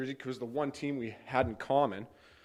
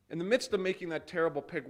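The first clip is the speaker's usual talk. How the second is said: normal talking voice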